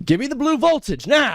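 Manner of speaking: high, bossy demand; spoiled star demanding a soda; diva order. Pretend.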